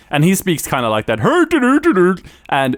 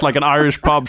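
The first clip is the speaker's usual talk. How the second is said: ordinary talk, nothing marked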